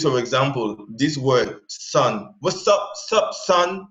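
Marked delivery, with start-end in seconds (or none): 2.4-3.9 Afro-American slang. Retold or imitated. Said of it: "they always use".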